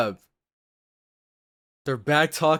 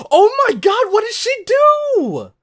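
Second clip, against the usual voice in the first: much higher and louder, horrified; shocked cry; 9 dB louder than usual. Real, not acted. Viewer horrified by the mother's act, high loud shocked cry. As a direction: gasp then cry out in alarm.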